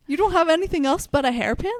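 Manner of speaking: high, loud, quick incredulous outburst; mock disbelief. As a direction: blurt it out in amused disbelief. Retold disbelief at a hairpin weapon.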